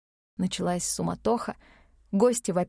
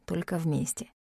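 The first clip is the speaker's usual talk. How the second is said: quiet; 3 semitones lower, 5 dB quieter than usual. Acted. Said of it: "тихо ответил он".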